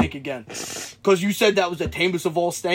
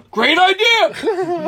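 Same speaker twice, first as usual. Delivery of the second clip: slow, high, loud shout; drawn-out retold yell; eager agreement; 12 semitones higher, 6 dB louder, much slower than usual. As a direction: seize the plan in comic frenzy. Retells group seizing the plan.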